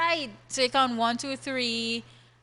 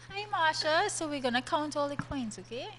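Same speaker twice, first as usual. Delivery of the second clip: put-on child-directed voice; 6 semitones higher, 3 dB quieter than usual. Shown as a demonstration. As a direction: talk to her like a preschooler. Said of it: "You have to talk to me like a child".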